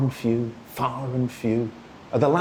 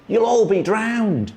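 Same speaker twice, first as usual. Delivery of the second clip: a raised, higher cry of alarm; 9 semitones higher, 4 dB louder than usual. A narrator's reading. Onlookers on shore warn the sailing Jumblies, mock alarmed cry. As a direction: call out the warning as if they'll sink. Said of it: "and everyone cried".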